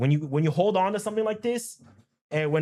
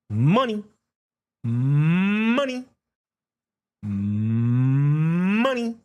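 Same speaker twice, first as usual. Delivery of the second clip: loud drawn-out money; will the price upward; a louder drawn call; louder urging; 5 dB louder, much slower than usual. Real, not acted.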